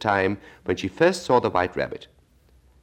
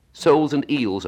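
impatient; 9 semitones higher, 6 dB louder than usual. Acted. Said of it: "rather impatiently".

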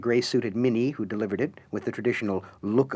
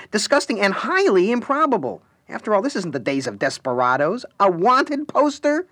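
mocking Harlequin; 11 semitones higher, 7 dB louder than usual. The Harlequin mocking the wanted poster to Alice, high theatrical disbelief. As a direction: stretch the last words in theatrical disbelief. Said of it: "the Harlequin said".

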